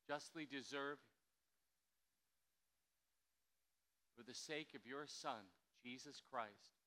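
prayer. Acted. Leads confession prayer.

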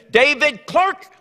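drawn-out mock call of a name; parent's yell. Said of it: "they'd yell down in that basement".

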